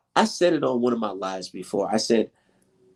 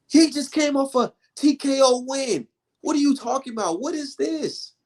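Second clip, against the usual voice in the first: higher, exasperated disbelief; what on earth, baffled and pitched up; ranting about the father's criticism, incredulous and higher; 13 semitones higher than usual. Real, not acted. Incredulous rant about dad.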